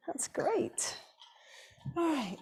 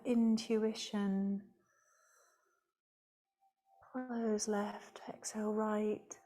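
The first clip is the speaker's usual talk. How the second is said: calm, measured yoga teacher voice, softer than chat; guided meditation; 4 semitones lower, 8 dB quieter than usual. Real, not acted. Yoga teacher leading the class through alternate nostril breathing, calm guided meditation. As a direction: guide the breathing with long pauses between phrases.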